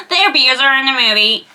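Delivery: The abuser in the movie, in a put-on American accent. Shown as a demonstration. Vlogger redoes the line in an American accent. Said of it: "I have to say it more American".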